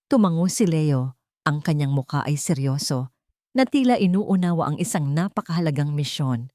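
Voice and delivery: plain reading voice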